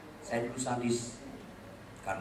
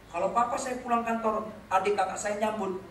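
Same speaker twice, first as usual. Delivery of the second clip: ordinary delivery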